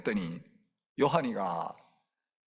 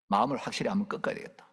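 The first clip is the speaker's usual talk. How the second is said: usual speaking voice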